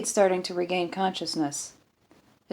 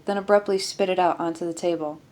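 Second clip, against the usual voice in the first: ordinary delivery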